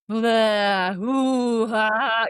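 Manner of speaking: loud playful blah ooh ah; hammed-up release vocalizing; mock release groans. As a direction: imitate letting it all out with sound. Husband hears her release sounds.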